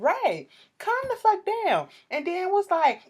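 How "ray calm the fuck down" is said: high, raised-voice reprimand, acted out; retold scolding. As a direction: re-enact telling someone off mid-argument. Retelling herself scolding Ray.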